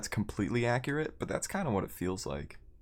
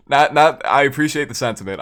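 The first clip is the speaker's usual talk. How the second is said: flustered; 6 semitones higher, 13 dB louder than usual. Real, not acted. Flustered by praise.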